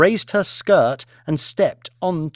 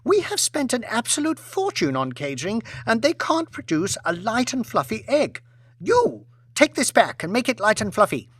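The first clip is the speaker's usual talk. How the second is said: whiny complaint; 8 semitones higher than usual. Acted. Lady complains to husband.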